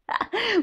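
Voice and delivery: short, high-pitched real laugh; quick high giggle; joking laugh. Real, not acted. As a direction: burst briefly into laughter at your own joke. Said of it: "Bon, on rigole".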